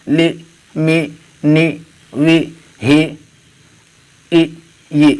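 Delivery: each letter with a long "ee", as in "بی، تی"; zer vowel drill. Shown as a demonstration. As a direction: recite "ای، بی، تی" one by one, ending in "ee", pausing between. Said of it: "زیر کی حرکت لگاتے ہیں تو اس کی آواز ای". (حروف "ای، بی، تی" زیر کے ساتھ، ای کی آواز میں الگ الگ)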